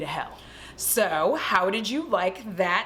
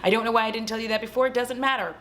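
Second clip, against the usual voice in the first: plain reading voice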